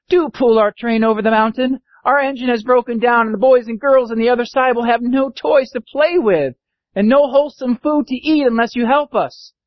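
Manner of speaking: high earnest plea; pleading dolls and toys. Acted.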